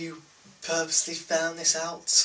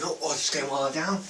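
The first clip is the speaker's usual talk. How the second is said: mocking chav voice; 4 semitones higher, 4 dB louder than usual. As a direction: taunt her like a cocky chav. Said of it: "the other guy's going".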